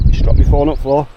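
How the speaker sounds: ordinary talk, nothing marked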